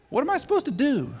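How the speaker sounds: high, exasperated cry of confusion; baffled. Retold or imitated. Recruit alone with his notes, comically baffled.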